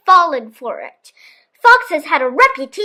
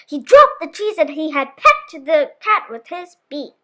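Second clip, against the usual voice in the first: usual speaking voice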